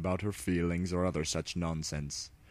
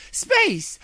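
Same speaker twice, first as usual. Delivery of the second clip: exclaiming; 10 dB louder than usual. Acted. Luna to herself, suddenly sure of the plan, shouting. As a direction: Space shouted much higher and louder.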